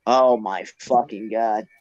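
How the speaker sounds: real exasperation, low and slow; exasperated disbelief. Real, not acted. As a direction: drag it out like you can't believe it. Exasperated at friend's claim.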